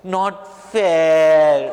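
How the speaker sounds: long drawn-out, loud complaint; sulky child. Acted. Hanuman complains about Sita like a child, sulky. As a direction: stretch the last word like a pouting kid. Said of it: "Why are you sounding like our Texas children".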